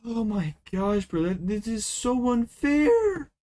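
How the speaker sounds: high, slow wailing complaint; half-sobbing protest at the loss; real whiny cry of unfairness. Real, not acted. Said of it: "I'm actually crying right now".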